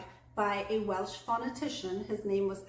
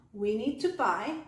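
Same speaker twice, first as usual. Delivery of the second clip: "We need to buy" held fairly even; steady intonation; 3 dB louder than usual. Shown as a demonstration. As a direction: keep "We need to buy" steady, leading into a list. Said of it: "pretty steady".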